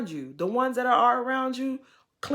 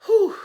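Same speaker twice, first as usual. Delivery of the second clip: loud exhale; 13 semitones higher, 10 dB louder than usual. Real, not acted. Reacting to Jen's legal trouble.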